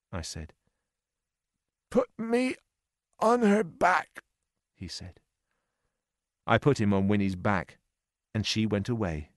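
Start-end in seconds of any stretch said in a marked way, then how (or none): 1.9-4.2 high, thin voice of an injured man; weak wounded man. A narrator's reading. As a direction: ask it faintly, like someone barely conscious.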